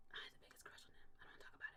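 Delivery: breathy secret whisper, barely voiced. Real, not acted. Whispering a secret crush to the co-hosts. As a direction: confide it under your breath.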